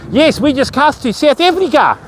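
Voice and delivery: put-on deliberately terrible South African accent, Africa sounding like "Efrica"; bad South African accent. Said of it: "in the worst ever South African accent possible".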